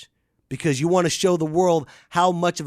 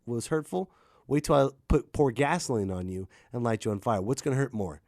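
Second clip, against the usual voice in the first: normal talking voice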